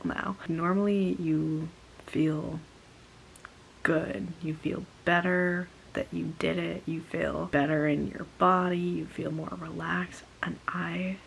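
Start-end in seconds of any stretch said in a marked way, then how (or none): none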